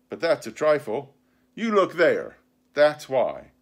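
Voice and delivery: an indignant sea cook dressing down his crew; pirate captain, scornful. Acted. Pirate leader answers his mutinous crew, scornful and forceful.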